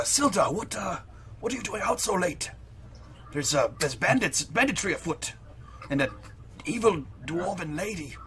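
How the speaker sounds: lightly put-on formal character voice, soft; adventurer character, urgent and hushed. Adventurer warns a friend of bandits, lightly voiced and hushed. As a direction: warn a friend discreetly, in character.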